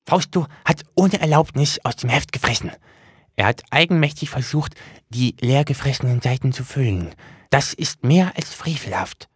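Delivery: high, odd little demon voice; high demon voice, proud of itself; book demon voice. Acted. Demon confesses his misdeed.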